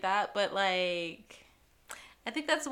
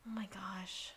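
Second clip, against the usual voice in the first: much softer voiceless whisper; 2 semitones lower, 14 dB quieter than usual. Real, not acted. Cohost reacting to the fries craving, whispered. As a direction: breathe it out in awe.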